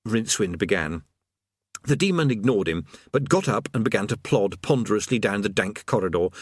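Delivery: plain reading voice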